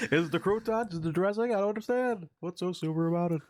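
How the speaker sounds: high-pitched observational stand-up voice; Jerry Seinfeld. Acted. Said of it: "that's a Jerry Seinfeld joke".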